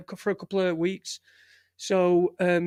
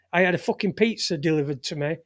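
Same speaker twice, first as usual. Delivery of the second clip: ordinary talk, nothing marked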